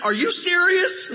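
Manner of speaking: incredulous. Pretend. Pretending the leaders react in stunned disbelief. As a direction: act shocked at the charge. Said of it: "And they never went".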